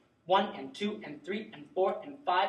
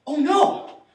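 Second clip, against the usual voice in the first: startled cry, higher and louder; alarmed; 9 semitones higher, 13 dB louder than usual. Acted. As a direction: react to seeing someone collapse. Finding a collapsed person.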